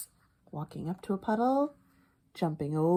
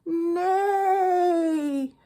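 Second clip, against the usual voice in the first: long loud high whinny; hammed-up horse neigh; 11 semitones higher, 6 dB louder than usual. Duck bellowed out a neigh.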